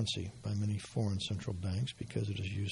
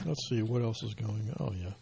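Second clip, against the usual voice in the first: normal talking voice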